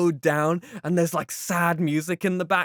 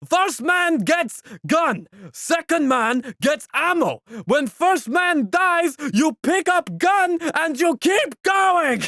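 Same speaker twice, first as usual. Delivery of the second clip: slow, high, loud orders to the recruits; barking Russian officer; 10 semitones higher, 5 dB louder, slower than usual. Retold or imitated. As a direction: hand out the guns like a shouting drill sergeant. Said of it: "the first guy's always just like".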